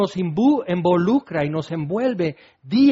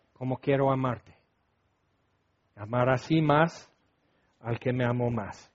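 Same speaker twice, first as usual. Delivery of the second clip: softer, lower, intimate prayer to God; hushed prayer; 5 semitones lower, 5 dB quieter than usual. Acted. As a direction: pray it inwardly, almost to yourself. Intimate prayer closing the sermon.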